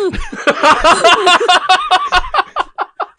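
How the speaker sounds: high, slow, laughing refusal; giggling too hard to go on; real laughter breaking the line. Real, not acted.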